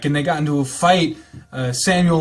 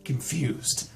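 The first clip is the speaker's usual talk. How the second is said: calm, even read-aloud narration; story narration; 4 semitones lower, 6 dB quieter than usual. Acted. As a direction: read the novel text evenly, like an audiobook. Reads visual novel narration.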